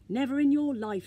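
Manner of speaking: firm refusal. A narrator's reading. Moomin refuses the swap.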